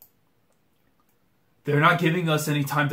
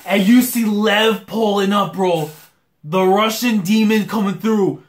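loud, higher-pitched hype commentary; excited play-by-play; 5 semitones higher, 5 dB louder than usual. Real, not acted. Reacting to Lev joining the rally, excited play-by-play. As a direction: call the rally with real excitement.

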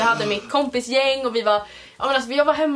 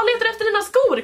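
cheery dad; 10 semitones higher than usual. Retold or imitated. The dad asks.

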